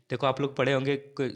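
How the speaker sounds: ordinary delivery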